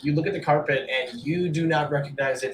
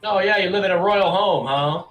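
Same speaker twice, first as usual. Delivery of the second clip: higher, louder sarcastic challenge; scoffing orc; 7 semitones higher, 6 dB louder than usual. Acted. Orc merchant doubting the adventurer's royal home claim, scoffing. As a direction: needle them with mock disbelief.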